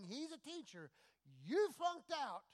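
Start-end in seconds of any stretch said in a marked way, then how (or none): 1.4-2.4 high, loud mocking jab; mock rebuke. Preacher mocking Peter for flunking out, loud and high. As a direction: scoff at him, pitched way up.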